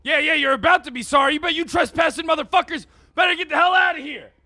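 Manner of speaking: high, loud enraged shouting at trespassers; furious cop yelling. Acted. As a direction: bellow them off your land like a furious cop.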